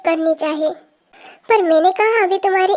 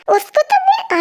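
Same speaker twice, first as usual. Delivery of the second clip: high, sudden angry burst; furious outburst; 13 semitones higher, slower than usual. Acted. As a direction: flare up in rage at the bully. Friend erupts at bully's name.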